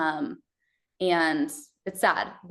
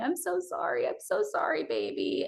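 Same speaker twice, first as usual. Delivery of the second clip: much higher, softer tearful apology breaking into breath; imitating sobbing to her newborn; retold crying apology; 10 semitones higher, 5 dB quieter than usual. Mother apologizing to her baby while in pain, imitating tearful sobbing. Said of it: "crying, saying".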